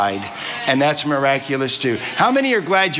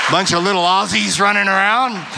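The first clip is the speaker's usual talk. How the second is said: higher-pitched go at an Australian accent; attempted Australian accent; 5 semitones higher than usual. Shown as a demonstration. American preacher joking about little Aussie grandchildren, attempted Australian accent. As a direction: try your best Australian accent, pitched up. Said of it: "this is my best Australian accent".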